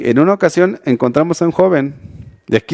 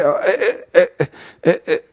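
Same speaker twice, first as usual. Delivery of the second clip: nervous stammer; 4 dB quieter than usual. Retold or imitated. Young man caught at the pilgrimage, stammering with a nervous laugh. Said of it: "Empezó a tartamudear".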